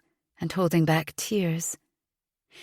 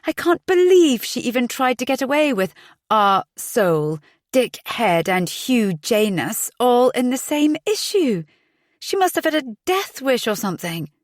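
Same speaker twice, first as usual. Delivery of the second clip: fuming; 7 semitones higher, 3 dB louder than usual. Acted. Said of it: "Imogen was fuming".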